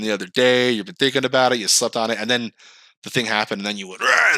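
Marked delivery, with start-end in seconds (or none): 4.0-4.3 sudden roar of rage, Frankenstein waking; mock roar. Man set off by a small thing, roaring. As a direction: bellow it out like a monster waking up.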